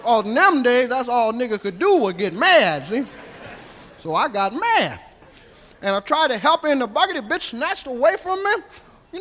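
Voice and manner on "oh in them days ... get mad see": ordinary talk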